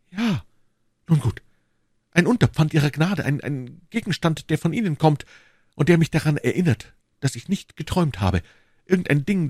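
eager, halting request for a favour; pleading duke. Acted. Duke asking the queen for a keepsake, eager. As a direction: beg with lifted, searching phrases.

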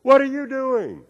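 high, slow echo of a yell. Acted. Canyon voice repeats the boy's question, echoing call. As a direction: mimic the call back high and stretched. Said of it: "And the voice said".

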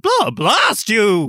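high, slow, drawn-out curse; hammed-up furious cry; angry shout. A narrator's reading. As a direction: bellow the curse in character. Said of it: "he shouted".

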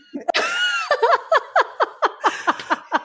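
high burst of laughter. Real, not acted. Absurd fortune read out.

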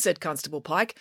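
usual speaking voice

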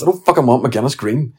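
ordinary delivery

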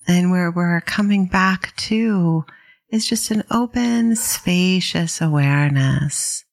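calm, unhurried meditation guidance with long pauses; guided meditation. Real, not acted. Meditation teacher guiding listeners to settle in, calm and unhurried. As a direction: ease listeners into settling, leaving space between phrases.

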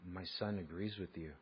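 hushed, low confiding secretary; real quiet. Retold or imitated. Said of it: "real quietly she says".

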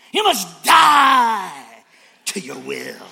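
stretched preacher shout on die; mock preacher shout. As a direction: bellow it like a fiery preacher. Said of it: "you hear a sermon".

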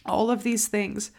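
usual speaking voice

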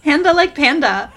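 high, loud, gleeful correction; amused correction. Real, not acted. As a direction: pipe up loudly, delighted by the rhyme. Teasing correction of the name.

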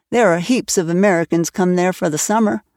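plain reading voice

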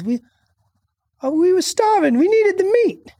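put-on high, pleading young boy. Boy voiced in retold scene.

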